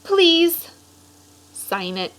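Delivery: lively storybook read-aloud voice; animated picture-book narration; reading to children. A narrator's reading. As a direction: read it like story time for a class.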